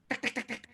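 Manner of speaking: quiet clicked tek tek; soft tongue-click knocking; knocking sound. Shown as a demonstration. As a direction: mimic knuckles on a door. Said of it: "ada yang ngetok lagi".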